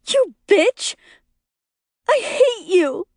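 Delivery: high, hateful shriek; furious yelling. A narrator's reading. Lori cursing her best friend, furious high yell. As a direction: hurl it at her in a high screech. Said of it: "she yells".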